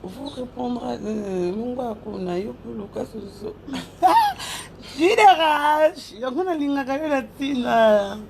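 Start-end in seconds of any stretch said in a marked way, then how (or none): 3.6-4.6 high laugh. Real, not acted. Reacting during live shoutouts. 5.0-6.1 drawn-out call. Real, not acted. Calling out a viewer's name.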